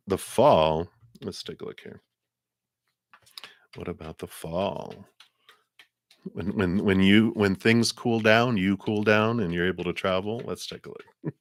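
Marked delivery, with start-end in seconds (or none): 1.2-4.9 under her breath; whispered aside. Real, not acted. Muttering aside to herself. 10.4-11.3 under her breath; whispered aside. Real, not acted. Muttering aside to herself.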